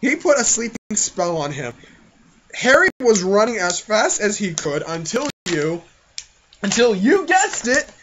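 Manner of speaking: ordinary talk, nothing marked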